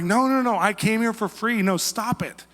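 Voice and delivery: high, loud pushing-away protests; flustered refusal. Retold or imitated. Preacher refusing the dictator's cash, high insistent protest.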